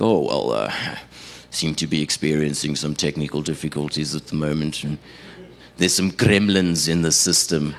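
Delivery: low, even on-air radio patter; smooth over the slip like a radio host; radio presenter covering up. Retold or imitated. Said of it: "they say things like".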